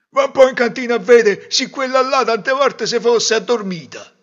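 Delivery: loud, high-pitched grumbling order; grumbling father. Acted. Father sending wife to check on daughter, loud grumbling. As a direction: send the wife off, loudly put out.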